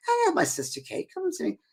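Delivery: high, puzzled Scottish lady; Scottish accent. Retold or imitated. Scottish lady recounts her sister's visit, high Scottish accent. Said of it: "I'm trying to my Scottish accent".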